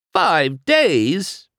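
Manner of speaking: high, loud, incredulous question; shocked cry. A narrator's reading. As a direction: cry out in disbelief. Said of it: "McQueen cried".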